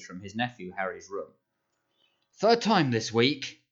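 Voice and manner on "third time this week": slow, loud roar at the breakfast table. A narrator's reading. Uncle roaring at nephew across the table, loud and slow. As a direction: bellow every word slowly. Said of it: "he roared across the table".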